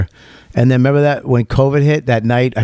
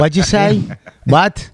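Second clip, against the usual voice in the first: mock deaf guy; 7 semitones higher, slower than usual. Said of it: "all he does on a radio show".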